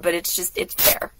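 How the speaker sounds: ordinary delivery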